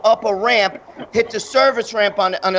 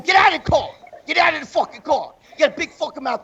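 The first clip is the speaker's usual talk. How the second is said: higher-pitched mock aggressor; imitated angry demand; 5 semitones higher, faster than usual. Ambulance worker ordering him out of the car, imitated anger. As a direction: bark the order, imitating a fighter. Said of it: "So she's going".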